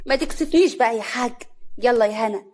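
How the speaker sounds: ordinary delivery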